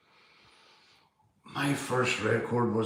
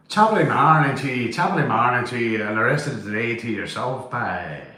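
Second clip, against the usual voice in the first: hammed-up stage Irish greeting; playful, over-the-top Irish brogue; Irish phrase; 5 dB louder than usual. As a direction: put on a mock Irish lilt, loud at the start. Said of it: "That's about as much Irish I can go".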